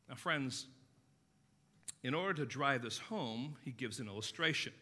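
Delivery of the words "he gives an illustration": ordinary talk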